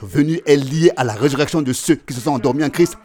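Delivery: driving, insistent sermon delivery; forceful preaching. Acted. Preacher telling the congregation when the Lord comes, forceful and insistent. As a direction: hammer each point home to a climactic Amen.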